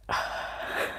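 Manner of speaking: long breathy sigh, no voice; heavy sigh. Real, not acted. Streamer sighs after the sung lines, heavy sigh. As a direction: exhale in mock despair. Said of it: "why would I put anyone through that".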